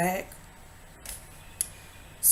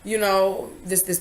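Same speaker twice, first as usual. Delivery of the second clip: normal talking voice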